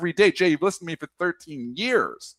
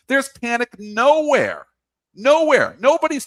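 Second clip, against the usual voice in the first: slow and higher, punching "nowhere"; emphatic rant; 6 semitones higher than usual. Real, not acted. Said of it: "ranting, repeated".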